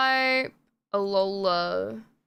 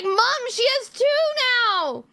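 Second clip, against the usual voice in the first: high-pitched mock child's exclamation; excited kid imitation; 11 semitones higher, 4 dB louder than usual. Neighbor's son spots a second Shiba, imitated excitement. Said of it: "he was so excited".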